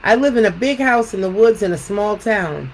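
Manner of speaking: measured storytelling with lilting questions; picture book read-aloud. Acted. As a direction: read to children, pausing after each thought.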